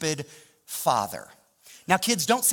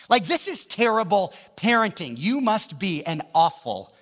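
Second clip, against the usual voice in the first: raised, louder voice pleading with God; indignant rant; 5 semitones higher, 4 dB louder than usual. Retold or imitated. Discouraged pastor rants at God about the failed move, indignant. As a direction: pile up grievances in acted outrage. Retold angry complaint to God.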